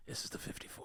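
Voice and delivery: whispered, flat reply to the screaming lady; under-the-breath retort. Retold or imitated. Driver telling the screaming passenger which bus this is, whispered dry reply. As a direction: mutter it barely audible, dry. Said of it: "I'm like".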